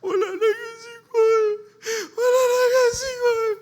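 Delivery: high, loud crying wail; grieving family wailing at the bedside. Pretend.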